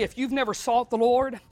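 ordinary talk, nothing marked

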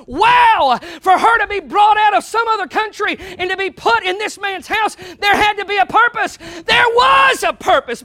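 loud, high preacher's cry; preaching at full force. Acted. Preacher stirring the congregation, shouting loud and high. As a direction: lift the room with it.

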